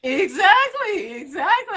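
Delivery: high, drawn-out excited exactly; squealing yes to the host; excited agreement. Real, not acted.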